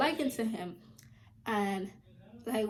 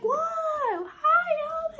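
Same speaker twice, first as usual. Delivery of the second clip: much higher, louder laughing whoa; mock surprised greeting; 7 dB louder, much slower than usual. Narrator walks in on them, laughing surprised greeting. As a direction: fake cheery hello with a laugh. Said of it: "I'm like".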